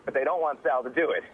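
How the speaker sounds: normal talking voice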